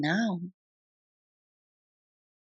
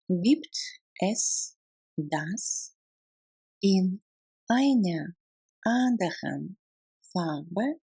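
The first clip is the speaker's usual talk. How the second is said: word by word. Shown as a demonstration. Teacher models a question about colour, word by word. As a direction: pause after nearly every word. Language drill, broken into words.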